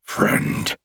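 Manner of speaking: whispery, growled scoff; monster scoff. A narrator's reading. Creature rejecting the hero's friendship, growling scoff. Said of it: "the creature scoffed".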